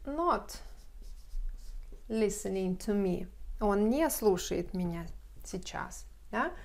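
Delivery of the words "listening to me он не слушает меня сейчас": normal talking voice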